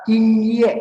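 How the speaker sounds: "ing ye": "ing" held high and level, "ye" falling; slow "ing ye" in first and fourth tones; first then fourth tone. Shown as a demonstration. Teacher reading out "ing ye" for students, first then fourth tone. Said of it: "nada pertama dan keempat".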